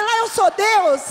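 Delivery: loud, slow mock boast; booming out a joke claim. Joking boast to André on phone. (gabando-se alto, devagar, de brincadeira)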